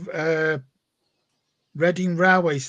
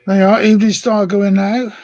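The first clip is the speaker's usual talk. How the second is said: proud loud announcement as the train sets off; loud call; 4 semitones higher, 12 dB louder than usual. Real, not acted. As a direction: call it out loud across the room.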